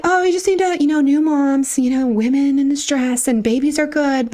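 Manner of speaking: high, airy mock-doctor voice. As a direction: brush it off like the condescending doctor, pitched high. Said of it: "So he's just like".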